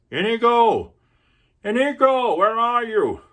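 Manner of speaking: high and loud, as if across a distance; calling out. Acted. Fezzik calls loudly for his lost friend Inigo. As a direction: shout his name to find him.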